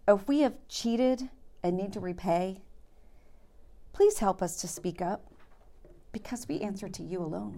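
earnest, fading prayer voice. Acted. Leads the group in prayer.

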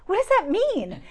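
high, loud mock puzzlement; retold bafflement. Husband questioning his wife's new prayer, baffled.

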